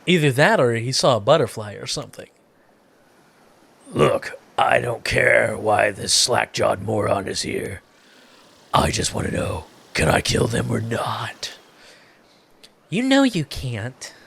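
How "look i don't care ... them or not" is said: menacing killer voice. Acted. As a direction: hiss it like a murderer.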